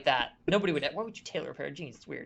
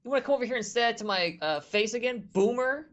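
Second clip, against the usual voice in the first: taunting; 7 semitones higher than usual. Acted. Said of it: "[name] says".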